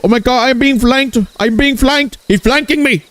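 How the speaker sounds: very high mock-panic Indian accent; hammed-up alarm in an Indian accent; panicked Indian accent. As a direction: squeal it in a caricature Indian accent. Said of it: "that's Indian Lobos right there".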